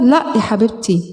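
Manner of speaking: usual speaking voice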